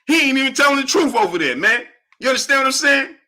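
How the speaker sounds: high-pitched protesting mimicry; mock indignant rival. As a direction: imitate a worked-up rival, pitched well up.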